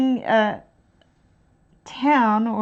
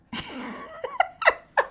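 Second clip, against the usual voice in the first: high squeal of laughter. Real, not acted. Laughing at own bad accent.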